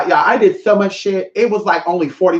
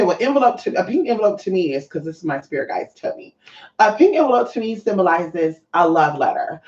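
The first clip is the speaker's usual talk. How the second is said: ordinary talk, nothing marked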